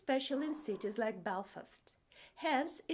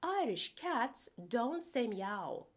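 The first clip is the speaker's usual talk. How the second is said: ordinary talk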